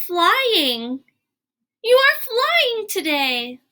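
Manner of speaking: high, slow, wide-eyed delight; amazed excitement. Acted. Gerald amazed that his friend can fly, high slow delight. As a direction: marvel at it like seeing a miracle.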